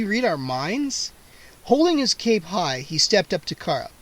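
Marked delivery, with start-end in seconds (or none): none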